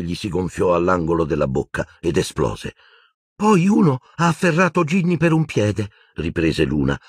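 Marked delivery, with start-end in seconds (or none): 3.3-5.9 put-on high-pitched girl character voice; Luna's urgent account. A narrator's reading. Luna resumes telling Harry of the attack, urgent. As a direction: pick up the story, high and urgent.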